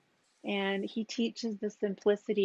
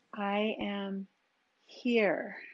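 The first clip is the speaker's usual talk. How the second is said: "here" set off after a pause and fuller than "I am"; stress on HERE; much slower than usual. Shown as a demonstration. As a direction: land on "here", a little stronger, with a falling tone. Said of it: "change the emphasis on those words".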